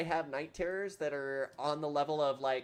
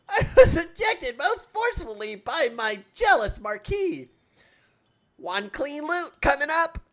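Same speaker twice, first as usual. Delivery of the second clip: high, dramatic cartoon voice; theatrical game character; 13 semitones higher, 8 dB louder than usual. Explaining the lute mishap to the shopkeeper, high and theatrical. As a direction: declaim it in a high put-on voice.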